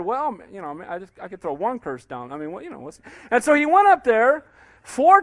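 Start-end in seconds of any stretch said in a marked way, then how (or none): 0.0-3.0 much softer, sheepish hedging; Balaam hedging, dropping to a much softer mumble; hesitant mumble. Retold or imitated. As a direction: let it trail off like a man talking himself into it. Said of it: "And then Balaam said".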